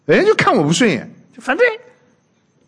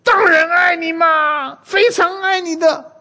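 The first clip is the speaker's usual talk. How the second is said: very high, drawn-out pleading; mock exasperated husband; 13 semitones higher, slower than usual. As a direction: protest love in a strained, hammed-up wail. 丈夫被逼急了辩解. (夸张地扯高嗓子拖长音辩解)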